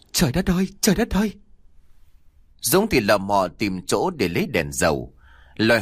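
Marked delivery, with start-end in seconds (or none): 0.1-1.3 high panicked wail of a frightened mother; panicked cry. A narrator's reading. Mother panics as the lights go out, crying out high. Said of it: "bà Trúc hoảng loạn".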